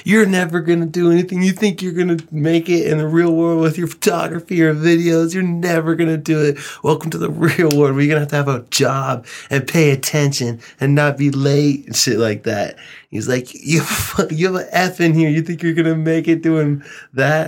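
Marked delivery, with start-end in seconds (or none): 0.0-11.9 raised, higher-pitched scolding rant; Mister Dane ripping into him. Retold or imitated. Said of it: "I'm getting ripped into". 13.5-17.5 higher-pitched mock teacher rant; Mister Dane scolding. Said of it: "I'm getting ripped into".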